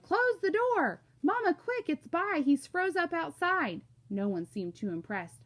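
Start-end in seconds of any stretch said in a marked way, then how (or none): 0.0-1.0 high, loud, drawn-out yell. A narrator's reading. Family yells at Kenny running in, loud shout. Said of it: "and Joey all yelled". 1.2-3.8 put-on high, loud frantic kid; urgent alarm. Kenny runs in to rescue Byron, urgent alarm.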